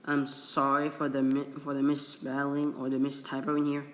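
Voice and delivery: normal talking voice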